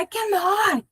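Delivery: stubborn "I cannot" with "not" hit; insistent cannot. Shown as a demonstration. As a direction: push back with a pointed "I cannot". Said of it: "o not passa a ser muito mais forte".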